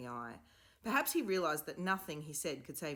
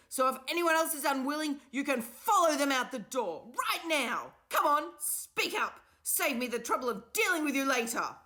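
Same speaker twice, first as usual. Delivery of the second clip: high, loud, cracking furious scream; 10 semitones higher, 9 dB louder than usual. A narrator's reading. The enraged professor screams at the class, furious. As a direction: lose control and scream it out. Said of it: "He screamed at them".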